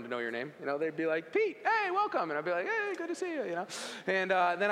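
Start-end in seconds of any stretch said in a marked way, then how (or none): 1.3-2.3 high, chirpy mock nurse welcome; cheery nurse greeting. ER staff greeting a regular patient, high and chirpy. 2.7-3.4 high, sing-song fake cheer; mock cheery reply. Injured teen greeting ER staff, high sing-song cheer.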